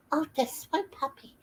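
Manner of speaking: high, soft coo to the dog; cooing to a puppy. Real, not acted. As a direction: owner coos at her puppy, high and soft.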